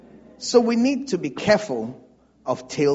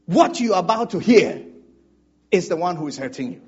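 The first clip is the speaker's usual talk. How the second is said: usual speaking voice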